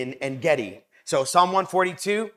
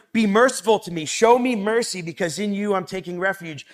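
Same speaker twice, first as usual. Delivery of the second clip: fervent psalm quote; 3 semitones higher, 3 dB louder than usual. Acted. Quoting psalm again, fervently.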